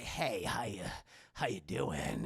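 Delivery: very low, quiet caller voice; creepy low caller. Retold or imitated.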